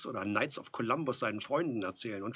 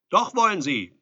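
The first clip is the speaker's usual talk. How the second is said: loud, high contradiction through a door; calling back; 8 semitones higher, 15 dB louder than usual. A narrator's reading. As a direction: call back insistently from outside. Visitor answers through the door.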